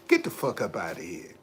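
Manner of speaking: dropped low and soft, brushing off a troll; low dismissal. Real, not acted. Streamer to rude commenter, low dismissive brush-off. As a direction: shoo them out with a low, dismissive growl.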